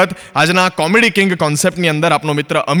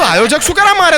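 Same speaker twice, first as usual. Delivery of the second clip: surprised question; 8 semitones higher than usual. Retold or imitated. Surprised retort to friend.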